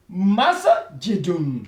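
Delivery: "Masjidun" drawn out syllable by syllable; slow model word. Shown as a demonstration. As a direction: model "Masjidun" slowly for the class. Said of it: "Vous voyez?". (« Masjidun » articulé lentement)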